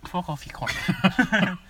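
a slow, put-on Irish accent; mock Irish accent. Friends joking, swearing at his mate in a put-on Irish accent. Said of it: "Why are you putting accents on?".

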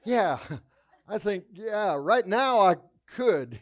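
laughing reply. Retold or imitated. Patient answering the mean orderly, laughing. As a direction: answer through a laugh, half joking. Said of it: "I'm like".

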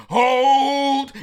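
a huge, high battlefield shout of command; army captain bellowing hold; commander's shout. Retold or imitated. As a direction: hold the line with everything you've got. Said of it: "And he says".

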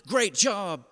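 imitating a coach's enthusiastic cheer; encouraging coach. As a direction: praise a player like an upbeat coach. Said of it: "he was an incredible encourager".

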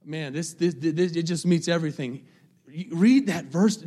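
normal talking voice

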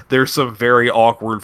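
ordinary delivery

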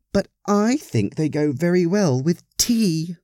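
high, knowing lady's voice, leaning on "tea."; conspiratorial. A narrator's reading. Parishioner confiding to the Reverend about muffins, high and conspiratorial. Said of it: "She added conspiratorially".

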